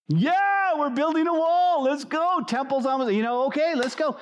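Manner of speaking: high, eager and keen; mock excited crowd cheering on the work. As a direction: pump it up like a fresh start. Imitating excited builders early on.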